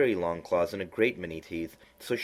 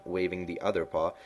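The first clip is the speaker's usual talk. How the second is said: usual speaking voice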